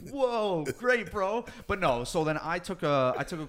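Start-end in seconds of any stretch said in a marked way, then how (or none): 0.1-1.4 high, loud mock-impressed cheer; mock amazement. Fighter mocking nutritionists with a weekend certificate, mock amazement. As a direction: hype up something you think is worthless.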